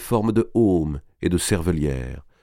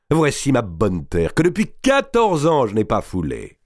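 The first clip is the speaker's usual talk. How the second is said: high, swelling proclamation of homecoming; exultant declaration; 5 semitones higher than usual. A narrator's reading. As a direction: declaim it with soaring joy. Géant retrouve sa terre après exil. (déclaration exaltée, voix qui monte)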